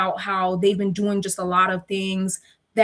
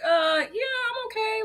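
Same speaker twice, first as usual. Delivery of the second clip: high nervous voice; 12 semitones higher, 4 dB quieter than usual. Retold or imitated. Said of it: "I'm like".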